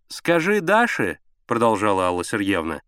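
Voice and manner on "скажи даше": high, drawn-out instruction; insistent high woman's voice; ordering wife. A narrator's reading. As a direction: give orders from the bedroom. Wife giving orders from bedroom.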